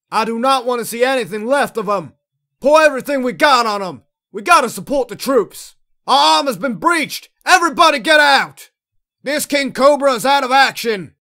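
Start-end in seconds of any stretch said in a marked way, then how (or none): 2.6-11.1 raised, higher voice over battle; urgent combat shout. Acted. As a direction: report damage loudly under fire. Crew in battle, armor breached.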